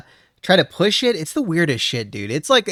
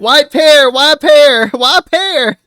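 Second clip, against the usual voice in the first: put-on southern accent; 12 semitones higher, 9 dB louder, much slower than usual. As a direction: chant it in a hammy southern accent.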